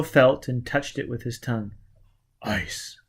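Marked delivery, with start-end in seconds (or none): 2.4-3.0 whispered and much softer; muttered. A narrator's reading. Foyle tasting the floor, muttering to himself. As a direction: breathe it out under the breath. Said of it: "he muttered".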